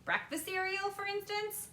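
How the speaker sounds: put-on high, excited boy's voice; eager boy. A narrator's reading. Boy quizzing Wonka about television, eager and high.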